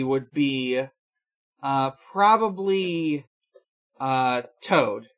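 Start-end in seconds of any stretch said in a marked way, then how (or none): none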